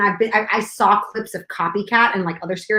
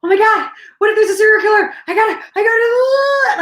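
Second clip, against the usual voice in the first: high, loud, fast panic; terrified in the bathroom, high frantic cry; panicked; 9 semitones higher, 6 dB louder than usual. Retold or imitated. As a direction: spiral into frantic fear. Panic about serial killer.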